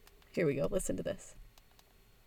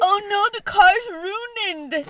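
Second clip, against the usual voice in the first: imitating a dismayed toddler; 9 semitones higher, 4 dB louder, much slower than usual. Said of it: "she's like".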